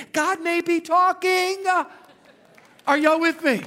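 high, loud, drawn-out proclamation; preacher's shout. Real, not acted. Preacher rousing the congregation to his point, shouting. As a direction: belt out the climax to the room.

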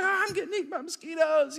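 high-pitched mock kid wail; hammed-up childish complaint; complaining kid. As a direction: imitate a child whining about bugs. Imitating kids complaining in the car.

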